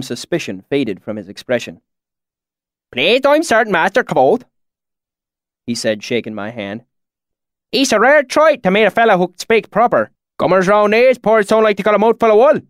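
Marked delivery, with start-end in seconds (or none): 2.9-4.4 high, loud thick rural dialect; thick deep valley accent. A narrator's reading. Swineherd greets strangers in thick valley accent. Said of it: "his accent so thick". 7.7-12.6 high, loud thick rural dialect; thick deep valley accent. A narrator's reading. Swineherd greets strangers in thick valley accent. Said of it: "his accent so thick".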